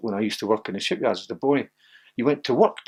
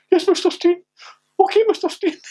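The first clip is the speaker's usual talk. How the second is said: much higher, small timid yes-man voice; meek high squeak; 5 dB louder than usual. Retold or imitated. The player meekly agrees with the manager on the phone in a tiny high voice. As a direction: shrink into a cowed schoolboy voice.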